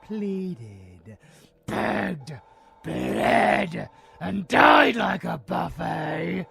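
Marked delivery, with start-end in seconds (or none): 1.7-6.5 rising louder and higher to a shout on died; villain relishing the victims' deaths; building relish. Acted. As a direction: build each word up to a gleeful yell. Building gleeful list of horrors.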